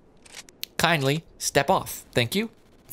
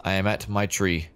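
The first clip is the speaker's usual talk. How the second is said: ordinary talk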